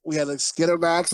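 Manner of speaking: usual speaking voice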